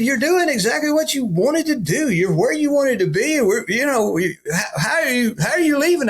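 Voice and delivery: high, baffled retelling of his boss; incredulous question. Retold or imitated. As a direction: voice climbing in disbelief. Pastor baffled that he's leaving.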